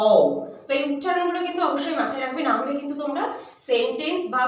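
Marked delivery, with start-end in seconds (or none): 0.1-0.1 "অ" said as the Hindi au, gliding from open to rounded; Hindi pronunciation. Shown as a demonstration. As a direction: glide "অ" like au. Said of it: "সেটা হিন্দিতে হয়ে যায়". ("অ" হিন্দি ঔ-এর মতো, "অও")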